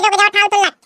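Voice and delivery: ordinary delivery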